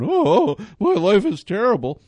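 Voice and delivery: hammed-up wail, high and loud; mock despair. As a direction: moan it like a mock drama queen. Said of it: "holding your head going".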